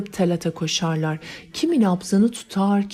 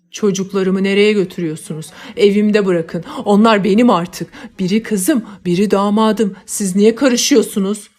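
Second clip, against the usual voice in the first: loud, desperate yelling after the crowd; a crazed father yells at the funeral crowd taking his children; frantic shouting; 8 dB louder than usual. Acted. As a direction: shout after them as they carry off your children. Mad father chasing funeral crowd.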